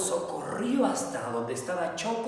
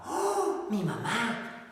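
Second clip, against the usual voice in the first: plain reading voice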